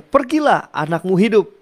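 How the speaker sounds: high ringing word of authority; commanding Jesus. Retold or imitated. Jesus tells the father his son lives, ringing with authority. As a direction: declare it with power. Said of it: "katanya kepadanya".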